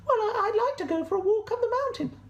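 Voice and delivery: very high, fast sheep squeak; Sally the sheep. Acted. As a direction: chirp the wish quickly, very high. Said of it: "Sally would say".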